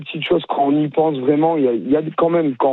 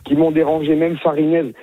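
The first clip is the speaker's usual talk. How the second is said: ordinary delivery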